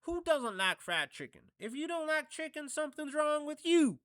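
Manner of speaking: high, loud put-on comedian voice; squeaky loud mock outrage; Chappelle bit impression. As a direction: jump way up high and push it loud like a stand-up bit. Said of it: "going back to the Chappelle bit".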